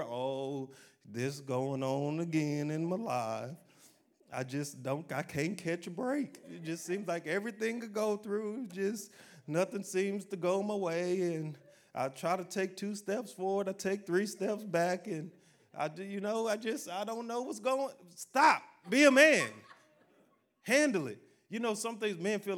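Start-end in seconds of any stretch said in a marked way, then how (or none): 0.0-17.9 slow, drawn-out whiny self-pity; whining complaint. Acted. Preacher mimicking a man who whines and pouts, whiny. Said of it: "We don't whine and complain". 18.1-21.2 loud, high, forceful rebuke; commanding shout. Acted. Preacher snapping a whining man out of it, shouted command. Preacher cuts off the imagined whiner.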